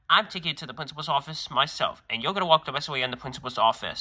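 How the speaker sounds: ordinary delivery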